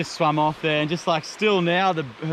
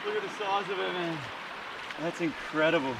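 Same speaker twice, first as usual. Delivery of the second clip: much softer, keeping it down by the water; hushed; 13 dB quieter than usual. Real, not acted. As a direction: murmur so as not to spook anything. Quietly releasing fish by the water.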